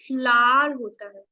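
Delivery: "फ्लावर" said as one syllable "flar", the w left out; silent w flower. Shown as a demonstration. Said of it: "व आपका silent होता है". ("फ्लावर" को "फ्लार" बोलो, व silent)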